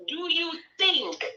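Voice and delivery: ordinary delivery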